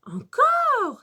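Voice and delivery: very high, loud, incredulous question; maîtresse étonnée. A narrator's reading. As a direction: shoot up in pitch with surprise. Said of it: "s'étonne la maîtresse". (étonnement aigu de maîtresse)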